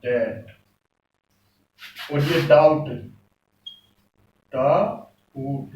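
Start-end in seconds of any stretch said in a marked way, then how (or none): 0.0-0.5 डेट said soft and low with p silent; slow model. Shown as a demonstration. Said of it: "ये है डेट". 4.5-5.7 डाउट drawn out very slowly, b silent; teacher slowly models डाउट. Shown as a demonstration. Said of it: "इसके proper हैं".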